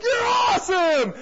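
high, drawn-out shout of praise; loud, stretched mock cheer; ecstatic fans. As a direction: imitate guys cheering wildly. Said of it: "These guys are like".

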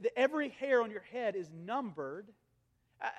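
usual speaking voice

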